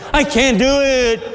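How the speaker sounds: put-on high child's protest; whiny little girl. Little girl refusing to walk on, whining. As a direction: whine it high like a small girl.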